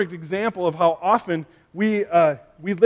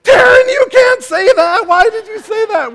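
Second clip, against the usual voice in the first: put-on kids shouting; 13 dB louder than usual. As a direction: shriek it like shocked siblings. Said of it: "all the other kids are like".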